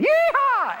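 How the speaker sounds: very high, loud yeehaw; gleeful high-pitched whoop; cowboy whoop. Retold or imitated. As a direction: let out a mock celebratory holler. Imitated vacation message's cowboy whoop.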